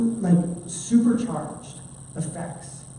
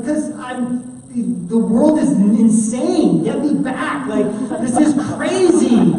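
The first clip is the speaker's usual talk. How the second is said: higher, louder, slow mock-panicked baby voice; newborn baby; 6 semitones higher, 6 dB louder, slower than usual. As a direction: play the newborn begging to go back. Voicing the newborn's reply.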